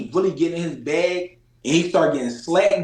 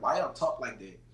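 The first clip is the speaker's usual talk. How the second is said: softer, lower quoted question; people's retold question; 6 semitones lower, 11 dB quieter than usual. People asking about Philly speech, softly quoted question. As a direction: drop it down as you quote the puzzled people. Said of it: "people be like".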